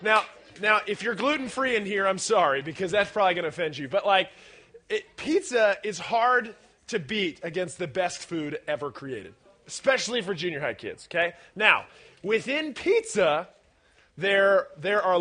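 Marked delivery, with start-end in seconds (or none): none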